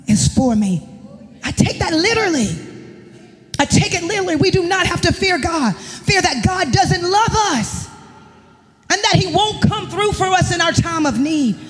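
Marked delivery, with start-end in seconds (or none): none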